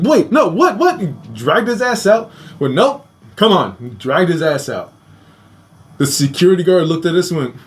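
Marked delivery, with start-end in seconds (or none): 0.0-1.0 imitated high-pitched, bewildered protest; mock flustered disbelief, pitched up. Man protests being dragged out. 2.7-3.8 brisk refusal. Retold or imitated. Guard hauls protesting man out.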